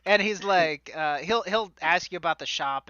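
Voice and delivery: ordinary talk, nothing marked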